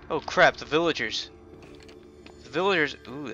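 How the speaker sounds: ordinary talk, nothing marked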